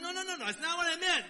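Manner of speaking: loud, quick, high backpedal; flustered protest. Retold or imitated. Retelling the girl who slapped him, panicked denial. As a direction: hurry to take it back, alarmed. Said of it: "I'm like".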